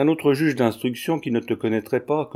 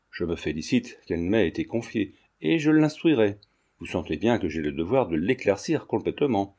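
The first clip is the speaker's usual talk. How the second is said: ordinary delivery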